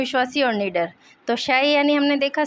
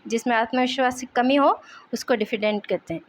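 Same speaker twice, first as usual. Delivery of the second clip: normal talking voice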